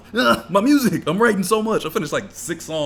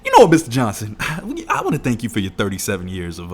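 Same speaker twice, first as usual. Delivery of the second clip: laughing through the phone script; 9 dB louder than usual. Retold or imitated. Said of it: "I said".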